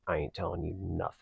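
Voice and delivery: low, quiet tough-guy mumble; hushed, quick, uncooperative crook voice; Chucky. Pretend. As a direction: mutter it fast like a tight-lipped doll. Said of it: "is that what his accent sounds like".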